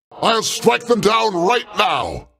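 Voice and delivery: a god calling down lightning; booming slow war cry; thundering threat. Acted. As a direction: shout it, slow and commanding. God threatens to strike foes.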